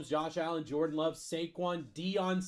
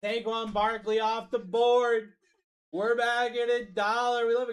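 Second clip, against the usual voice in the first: hype announcer call; 5 semitones higher, 4 dB louder than usual. Real, not acted. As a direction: stretch out board and dollar for the crowd. Live break host announces a pick.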